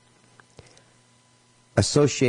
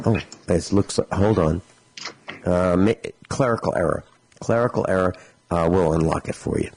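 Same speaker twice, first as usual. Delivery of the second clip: sheepish agent; 5 semitones lower than usual. Retold or imitated. Company agent discovers own mistake.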